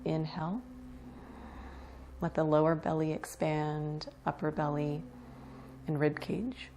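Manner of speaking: unhurried meditation guide with long pauses; guided meditation. Acted. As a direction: ease them into relaxation, leaving space between phrases. Leads breath awareness meditation.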